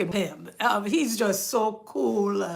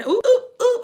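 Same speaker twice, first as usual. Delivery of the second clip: much higher mock squeal of "ooh"; teasing the dancing nurses; 12 semitones higher, 5 dB louder than usual. Mocking TikTok nurse dances.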